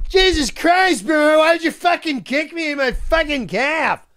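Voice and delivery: high, hammed-up wounded yelling; imitated pained outrage. Castmate kicked in the calf, mock anguished protest. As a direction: wail the complaint as the kicked player. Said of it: "And he goes".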